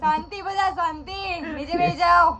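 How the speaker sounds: mock crowd control. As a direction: playfully hush the audience. Hushing a noisy audience. (મજાકમાં ઊંચા અવાજે શાંતિ માટે બૂમ)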